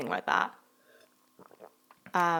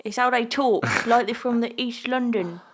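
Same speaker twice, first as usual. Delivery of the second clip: higher-pitched teasing East London imitation; mock East London accent; 4 semitones higher than usual. Co-host teasing how they talk, mock East London accent. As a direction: mimic them as a would-be East Londoner. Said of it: "you're trying to sound like".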